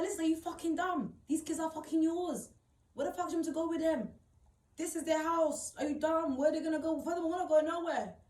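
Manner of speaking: high-pitched angry rant; indignant retelling of an angry tirade; retold mad outburst. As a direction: reenact losing it, pitch held up. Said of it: "Like, I went mad".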